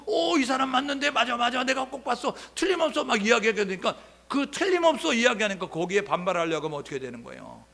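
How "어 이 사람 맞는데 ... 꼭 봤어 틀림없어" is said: high, loud, insistent; insistent accuser. Retold or imitated. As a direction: pile on, sure of it. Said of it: "강도가 점점 세지잖아요". (점점 세게 우기며 확신하는 목소리)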